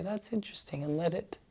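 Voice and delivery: soft, slightly lifted aside; note it very gently and let go; hushed murmur to oneself; detached self-talk. Acted. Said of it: "just say".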